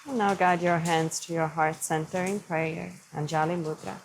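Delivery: calm yoga teacher easing the class into stillness; guided meditation. Acted. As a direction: lead them gently through the breath. Guides a seated breathing practice.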